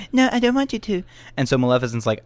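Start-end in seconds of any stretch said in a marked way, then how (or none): 0.1-1.0 much higher princess voice; imitating Aurora. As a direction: plead in a high princess voice. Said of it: "Aurora's like".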